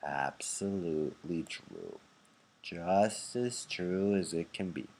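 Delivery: put-on three elderly nodding in agreement; slow soft elderly chorus; old people agreeing. A narrator's reading.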